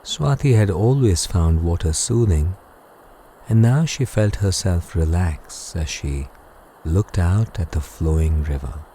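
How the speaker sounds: ordinary delivery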